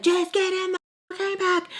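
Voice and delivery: high-pitched imitated angry yell; retold exasperated shout. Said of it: "He yells at me".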